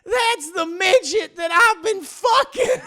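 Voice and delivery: high, loud, slow wail; hammed-up confession. Wife confesses to her husband, overwrought mock wail. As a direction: blurt it out in a stretched cry.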